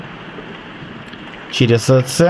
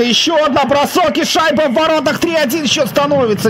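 excited goal call; 7 semitones higher than usual. Real, not acted.

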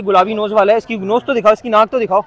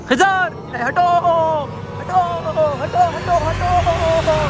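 higher-pitched calls of हटो to clear the way; calling out; 8 semitones higher, much slower than usual. Real, not acted. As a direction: shoo people out of the path, voice raised high. Calling people to move aside. (ऊँची आवाज़ में हटो पुकारना)

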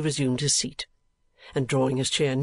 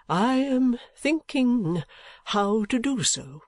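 put-on higher, drawn-out old voice, a touch sad; 10 semitones higher, much slower than usual. A narrator's reading. Old gentleman thinking how to go on, sad. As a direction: ponder it slowly, mildly saddened.

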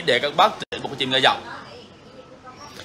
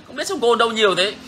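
sarcastic disbelief; 7 semitones higher than usual. Real, not acted. Mocking online sellers' fake claims.

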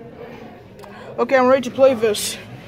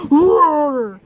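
a loud, growling pretend-monster roar; 3 dB louder than usual. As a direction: roar to play the scary monster. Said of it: "I'm the negative monster".

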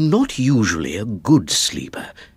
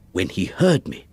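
ordinary delivery

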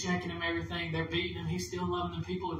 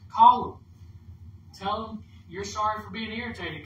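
loud urging; 3 semitones higher, 6 dB louder than usual. Real, not acted. Preacher urging listeners to make peace, loud command. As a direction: shout the command, higher and louder.

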